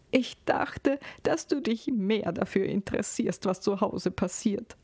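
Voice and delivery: high, wounded, near-tears voice; tearful reproach. A narrator's reading. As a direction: reproach your husband through acted tears. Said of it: "bricht in Tränen aus".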